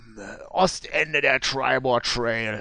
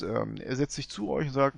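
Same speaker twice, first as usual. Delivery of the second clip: normal talking voice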